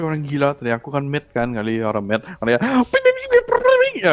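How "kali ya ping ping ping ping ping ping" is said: mock ping sounds. Imitating ping sounds rising.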